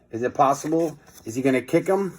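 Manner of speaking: ordinary delivery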